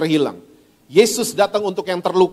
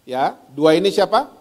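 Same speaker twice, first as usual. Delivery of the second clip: normal talking voice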